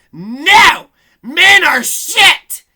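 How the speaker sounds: high, loud, very slow shout; hammed-up angry yell at men; mock furious shout. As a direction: bellow it as the imagined cashier. Imagined cashier's thoughts shouted.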